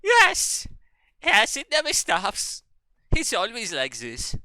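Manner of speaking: high, loud mock German outburst; slow, hammed-up German-accented lament; cartoon German replier. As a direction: exclaim it squeakily, easing down toward the end. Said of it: "something like".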